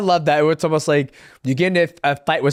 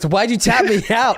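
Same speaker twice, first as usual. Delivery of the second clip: laughing quote; 6 semitones higher than usual. Retold or imitated. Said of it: "he goes".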